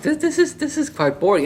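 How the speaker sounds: lightly voiced bored father; imitating dad. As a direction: voice dad complaining it's dull. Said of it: "even my dad was just like".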